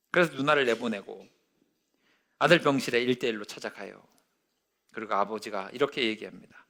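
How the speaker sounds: usual speaking voice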